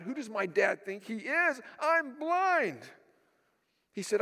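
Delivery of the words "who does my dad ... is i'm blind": imitating an angry, incredulous son; indignant anger. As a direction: voice leaping high on a retold protest. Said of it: "I was so angry".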